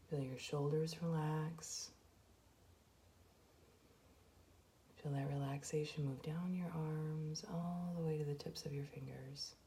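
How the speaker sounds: soft, even meditation voice with long pauses; guided meditation. Acted. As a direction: guide them gently through each breath, hushed and unhurried. Leads a guided breathing meditation.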